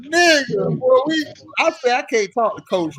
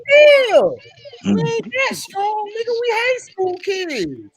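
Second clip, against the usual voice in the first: much higher, startled complaint; retold protest; 12 semitones higher, slower than usual. High school player protesting to coach, high and startled. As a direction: squeal in disbelief at his strength. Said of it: "I'm like".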